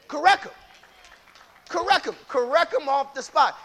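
3 call it out loud to the church; 1 loud urging. Real, not acted. 4 preacher urging the congregation to correct, loud and high.

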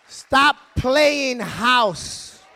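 very slow, every word stretched; drawn-out rebuke. Acted. Pastor rebukes the men, very slowly. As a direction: stretch it out as a warning.

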